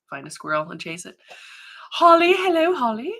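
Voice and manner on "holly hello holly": high, loud singsong hello; excited greeting. Real, not acted. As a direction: greet an arriving friend with delight. Greets a viewer joining in.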